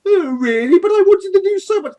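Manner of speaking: high, loud theatrical pleading; Pegasus Maximilian. Acted. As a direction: whine grandly at being dismissed.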